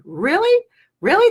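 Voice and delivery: mock disbelief. Real, not acted. Mocking the complaining caller.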